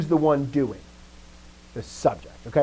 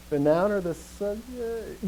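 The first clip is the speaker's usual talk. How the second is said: slow, higher, drawn-out mock uncertainty; imitating hedged answer; 6 semitones higher, slower than usual. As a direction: hedge it like an unsure student. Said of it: "That's how we hedge our bets".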